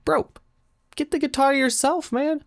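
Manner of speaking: high-pitched exasperated comeback; exasperated retort. Acted. Reader talking back to the demanding couple, high exasperated retort. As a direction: push the pitch way up in mock protest.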